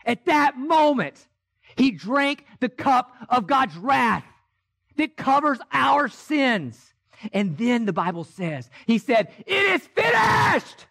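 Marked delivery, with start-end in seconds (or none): 0.0-6.9 the same words again, now a slow full-voiced proclamation; preaching shout. Climax of the sermon. 9.5-10.8 high, slow, ringing cry; a high, slow shout of completion. Retold or imitated. Jesus' last words on cross.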